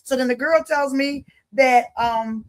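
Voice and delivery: normal talking voice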